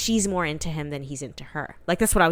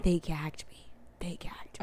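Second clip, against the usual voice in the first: whisper; 12 dB quieter than usual. Real, not acted. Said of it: "whispered twice".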